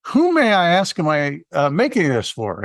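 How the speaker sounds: high-pitched, chirpy customer greeting; excited barista. Retold or imitated. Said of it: "to be that excited".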